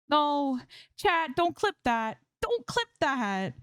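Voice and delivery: high, slow, whining plea to chat; high drawn-out whine; whiny complaint. Acted. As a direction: beg chat not to clip it, mock-mortified. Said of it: "she complains".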